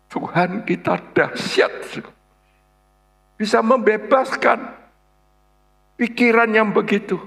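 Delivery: high, ringing proclamation; exultant preaching. Real, not acted. Preacher proclaiming God's power to congregation, exultant preaching. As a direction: proclaim it forcefully, pitched well up.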